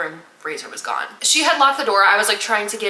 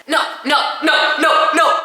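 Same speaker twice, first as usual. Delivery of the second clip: high, louder string of refusals; insistent refusal; 7 semitones higher, 5 dB louder than usual. Retold or imitated. Retelling her nonstop refusals, insistent and high. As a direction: fire off each word, pitched up and forceful. Retold refusal, rapid repeated no.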